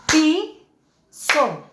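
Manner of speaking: "piso" held and split, pi... so; syllable by syllable. Shown as a demonstration. Teacher counts beats of voice, syllable by syllable. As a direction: say "piso" as two separate beats. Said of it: "Entonces decimos".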